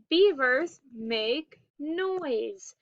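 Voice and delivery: "beavers make noise" slowly, word by word; slow model reading. Shown as a demonstration. Teacher reading a story title to pupils, slow and deliberate.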